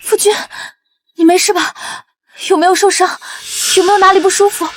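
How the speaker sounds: high, worried questions; anxious concern. Acted. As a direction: fuss over a loved one who may be hurt. Wife frantic over husband.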